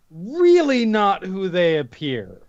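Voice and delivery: ordinary talk, nothing marked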